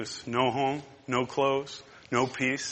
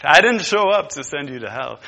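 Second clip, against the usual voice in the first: louder, punching didnt; emphatic declaration; 9 dB louder than usual. Acted. Jesus declaring to the sinner, loud emphasis on didnt. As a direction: proclaim "didnt" with force. Repeat with force.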